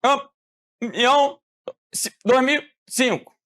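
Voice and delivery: broken words with campeão dragged out very slowly; choppy, syllables missing. Shown as a demonstration. Teacher again imitating a broken internet call, dragged and choppy. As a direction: stutter through it like a lagging call. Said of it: "Você entendeu a frase?".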